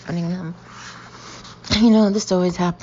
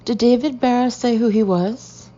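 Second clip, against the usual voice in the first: raised voice carrying to the hall; calling out; 6 semitones higher, 6 dB louder than usual. A narrator's reading. Shouting a question to Suze down the hall, loud. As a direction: call the question loudly. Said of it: "I yell".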